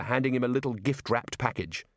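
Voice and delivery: ordinary delivery, nothing marked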